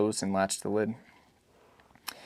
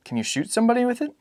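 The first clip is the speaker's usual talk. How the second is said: put-on much higher boy's voice; curious small son; a child's innocent question; boy asking; faster than usual. Boy's voice in read dialogue.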